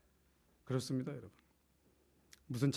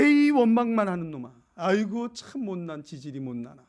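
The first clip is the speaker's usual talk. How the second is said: loud, exasperated jeering; mock scolding; 5 semitones higher, 10 dB louder than usual. Preacher imagining how he'd scold Gideon, mock exasperated. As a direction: tut and scoff at a useless man. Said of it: "저 같으면 그렇게 불렀을 것 같아요".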